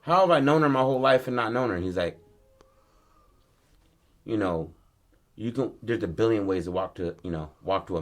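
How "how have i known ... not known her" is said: raised-pitch, pressing question; mildly exasperated disbelief; acted bewilderment. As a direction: demand an answer, higher and quicker. Acted son's bewildered realization.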